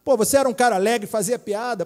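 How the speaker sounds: normal talking voice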